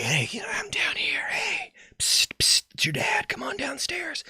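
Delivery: breathy, voiceless whisper, coaxing; ghostly whisper. Retold or imitated. Dead dad whispering to his daughter from the stairwell, eerie whisper. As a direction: whisper like a ghost luring a child.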